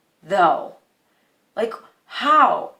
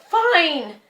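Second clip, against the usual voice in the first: high, sharp retort; acted-out fed-up concession; exasperated surrender; 8 semitones higher than usual. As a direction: give in angrily, pitched up. Giving in during retold quarrel.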